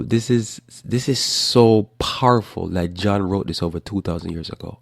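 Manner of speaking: ordinary delivery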